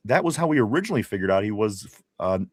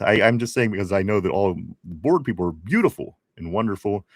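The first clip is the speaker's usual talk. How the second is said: ordinary delivery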